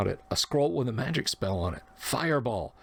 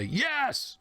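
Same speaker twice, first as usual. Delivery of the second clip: imitated cheer. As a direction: mimic a player celebrating a hit. Retold player cheering a hit.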